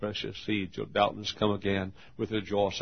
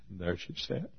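normal talking voice

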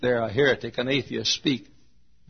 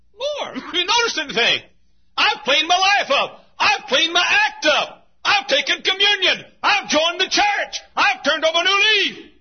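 proud sinner; 8 dB louder than usual. Acted. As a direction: proclaim every good deed with pride. Sinner listing good deeds.

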